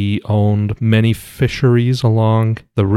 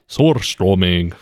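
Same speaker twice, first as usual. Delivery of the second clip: Russian accent; 2 semitones higher than usual. Shown as a demonstration. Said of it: "It totally sounds Swedish with that Russian accent".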